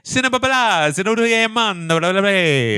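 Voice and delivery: high mock Italian gibberish; fake Italian. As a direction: spout nonsense with an Italian swing. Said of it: "it's me going".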